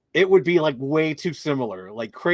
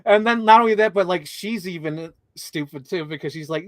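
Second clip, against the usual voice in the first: ordinary talk, nothing marked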